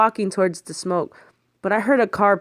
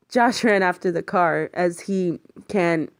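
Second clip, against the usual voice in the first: ordinary delivery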